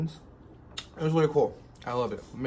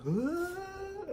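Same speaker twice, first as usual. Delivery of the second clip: startled cry. Retold or imitated. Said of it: "I was like".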